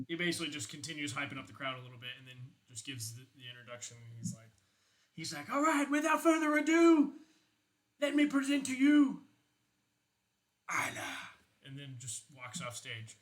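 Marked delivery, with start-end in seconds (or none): 5.5-11.2 high, loud hype-man shout; goblin emcee. Acted. Goblin emcee shouting to the concert crowd, high and loud. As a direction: pump up the crowd like a rock emcee.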